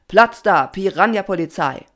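loud, commanding motto; rallying call. A narrator's reading. Papa gives the swarm its motto, loud commanding call. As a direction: call it out as the leader. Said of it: "Alle antworteten".

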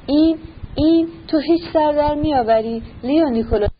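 a touch of exasperation, held back; mild irritation. A narrator's reading. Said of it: "با عصبانیت گفت".

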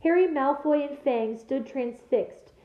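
ordinary delivery, nothing marked

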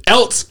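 high "else" with a T slipped in before the s; teasing else with a T. Retold or imitated. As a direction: tease her by saying "else" as "elts". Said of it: "She puts a T in the fucking word".

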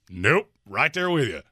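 ordinary talk, nothing marked

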